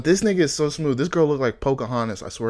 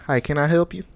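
put-on higher-pitched country-accented greeting; 4 semitones higher than usual. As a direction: shop clerk putting on a country accent. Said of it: "talk in a country accent".

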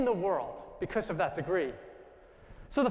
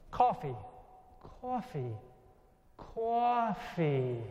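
put-on echoing New York accent; much slower than usual. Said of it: "the way I was saying it with my New York accent".

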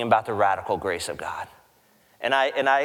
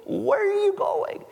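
much higher, startled guard shouting after him; Roman guard. Retold or imitated. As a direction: squeak it out like a baffled Roman guard. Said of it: "You can imagine the Roman guard".

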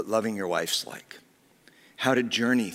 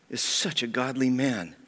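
normal talking voice

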